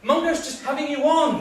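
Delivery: high, loud reprimand; loud, urgent scolding; exasperated shout. Acted. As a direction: shout over the panic to calm it. Trying to stop the panic.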